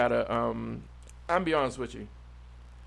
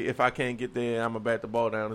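mocking quote; 6 semitones lower, 7 dB quieter than usual. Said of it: "only people that say".

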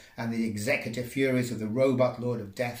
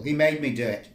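pleading shout; 4 semitones higher, 6 dB louder than usual. Acted. Pleading with the judge.

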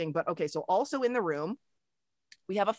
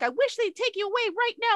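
put-on much higher, whiny bratty girl voice; mock Sarah wishing the baby away; older sister snapping at her crying baby brother, high and whiny; bratty teen sister; 4 dB louder than usual. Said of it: "When she's like".

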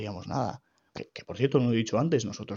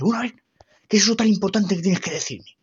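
impatient mother; 7 semitones higher, 6 dB louder than usual. Retold or imitated. Mother demands explanation.

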